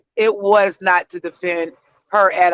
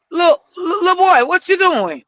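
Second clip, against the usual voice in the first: higher-pitched call to a grandson; stern grandma voice; 8 semitones higher than usual. Shown as a demonstration. Grandma checking her grandson, demonstrated stern voice. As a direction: pull the little boy up short. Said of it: "I put that bass in my voice".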